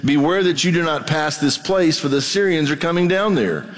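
preacher reading Scripture aloud to his church. Acted. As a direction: read the passage out to the congregation. Reads scripture passage aloud.

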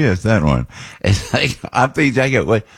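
ordinary talk, nothing marked

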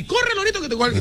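lightly voiced parrot. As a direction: chirp it like a pet parrot. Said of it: "nunca aprendió a decir".

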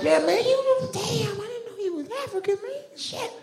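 shrieky falsetto, way up high; very high, incredulous mock Southern voice; high-pitched Southern surprise. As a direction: squeal it like a stunned Southerner. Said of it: "their voices go up really high".

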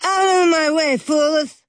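high, loud mock-dramatic bellow; theatrical shout. A narrator's reading. Girl waking and dramatically ordering everyone aside, hammed-up shout. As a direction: bellow it like a stage hero. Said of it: "Pinky suddenly sat up straight and shouted".

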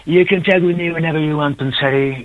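high, drawn-out English-speaker impression; slow, menacing English "guiri" voice; guiri English accent. Shown as a demonstration. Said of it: "Hay unos cuantos guiris".

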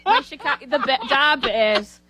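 slow, drawn-out Chicago accent on da Bears; thick Chicago sports-fan accent, a touch hammed-up. Shown as a demonstration. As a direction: lean into a lightly done Chicago accent, stretching da Bears. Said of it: "I guess I can do my Chicago".

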